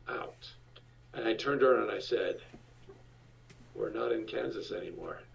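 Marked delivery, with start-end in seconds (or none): 3.7-5.2 low, much softer dry remark; deadpan aside. Retold or imitated. Husband to freaked-out wife, low soft deadpan. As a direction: murmur it to her flatly.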